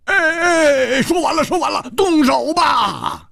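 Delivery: high-pitched urgent yell; loud shout. A narrator's reading. Two fighters cutting in to call the attack, loud shout. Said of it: "不约而同大声说".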